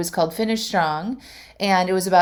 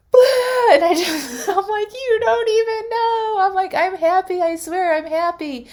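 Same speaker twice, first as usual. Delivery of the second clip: much higher, sobbing and laughing at once; laughing through tears; 13 semitones higher, 4 dB louder than usual. Retold or imitated. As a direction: relive the happy crying, voice cracking up high. Retelling sobbing with joy to waiter.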